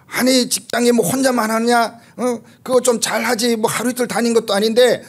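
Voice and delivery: mock nagging. Husband lecturing his upset wife about her job, mock nagging tone. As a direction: needle her like a preachy husband. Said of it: "이렇게 하면 어떻게 되겠어요".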